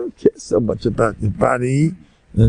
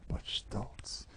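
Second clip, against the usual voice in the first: hushed; 23 dB quieter than usual. Acted. Describing a humble yet proud man.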